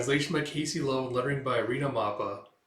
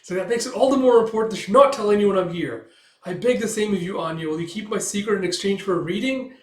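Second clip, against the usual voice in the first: louder, higher pressing voice; raised-voice frantic plea; urgent Melinda; 6 semitones higher, 7 dB louder than usual. Acted. Melinda urgently begging secrecy.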